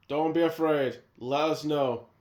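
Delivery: normal talking voice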